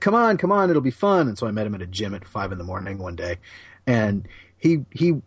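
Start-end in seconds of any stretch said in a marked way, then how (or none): 0.0-1.3 eager trainer voice. Retold or imitated. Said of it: "and he said".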